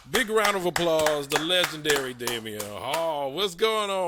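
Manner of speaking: booming announcer intro. Real, not acted. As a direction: bring the guest on big and loud. Host introduces the guest loudly.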